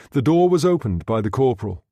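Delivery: usual speaking voice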